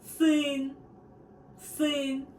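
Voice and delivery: сын said slowly and clearly; сын with a clear Russian ы; Russian word сын. Shown as a demonstration. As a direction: model сын for the learner to repeat. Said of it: "family member".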